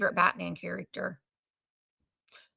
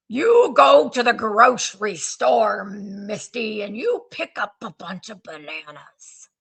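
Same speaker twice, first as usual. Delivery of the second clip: put-on slow, louder Scottish Sean Connery accent; Sean Connery impression; 5 dB louder than usual. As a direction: drawl it out like a playful Scottish Bond. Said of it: "the way that he talks like this".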